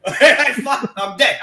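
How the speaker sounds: words burst through laughter; laughing. Real, not acted. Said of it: "laughing at the scene".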